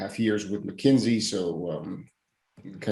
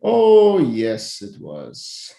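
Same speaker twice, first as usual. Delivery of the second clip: emphatic agreement; 5 dB louder, much slower than usual. Real, not acted. Agreeing it was so weird, emphatic. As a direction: swoop down from a big drawn-out oh.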